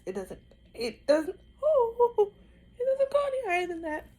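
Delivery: rising strained cry; pained strain. Real, not acted. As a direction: voice climbing high in pain. Straining to lift painful arm.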